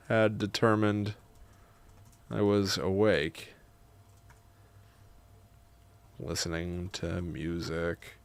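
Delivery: measured, soft reading with long pauses; memoir narration. A narrator's reading. Writer narrating grandma's visit, measured memoir reading. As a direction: read it quietly, phrase by phrase.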